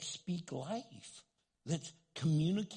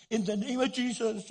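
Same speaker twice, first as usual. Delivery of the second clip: loud, raised-pitch invocation; forceful prayer; 7 semitones higher, 8 dB louder than usual. Retold or imitated. Chaplain prays while doing compressions, retold loud invocation. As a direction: act out an urgent prayer mid-effort.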